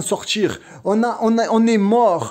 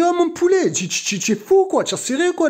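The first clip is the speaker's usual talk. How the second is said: higher, imitating the sister snapping back; mock indignant retort, pitched up; retold outburst; 9 semitones higher than usual. The sister snapping at a man outside the bakery, mock outraged. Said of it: "Et la sœur, elle a craqué".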